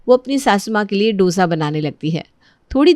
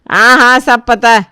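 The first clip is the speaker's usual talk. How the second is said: scornful mother-in-law; dismissive scorn; 8 dB louder than usual. Acted. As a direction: cut off the excuse loudly. Mother-in-law sneers at the excuse.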